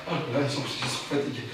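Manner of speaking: low, soft exhausted sigh; weary. Acted. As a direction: let it sag out tiredly. Exhausted trailing.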